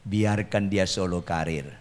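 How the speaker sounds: low, slow, softened plea to God; hushed and measured, as if praying inwardly; mock-meek prayer. Silent husband praying while his wife rants, hushed and meek. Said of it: "Saya bilang sama Tuhan".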